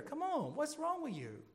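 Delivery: exasperated Paul. Retold or imitated. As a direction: throw up your hands at foolish Galatians. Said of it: "That's Paul's "Come on, man," moment".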